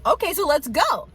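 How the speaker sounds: the daughter's excited acceptance of the dare; eager comeback. Retold or imitated. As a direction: fire it right back, game on. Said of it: "And I was like".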